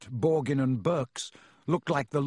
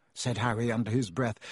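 ordinary delivery

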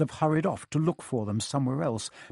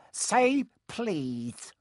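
high teasing lilt; Peeves, annoying sing-song; 8 semitones higher than usual. A narrator's reading. Peeves toying with Filch, sing-song. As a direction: taunt in a high, lilting voice. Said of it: "said Peeves in his annoying sing-song voice".

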